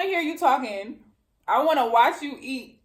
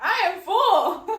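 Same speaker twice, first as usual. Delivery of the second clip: laughing; 9 semitones higher, 4 dB louder, slower than usual. Real, not acted. Laughing while stuffed with tacos.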